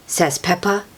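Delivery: ordinary delivery, nothing marked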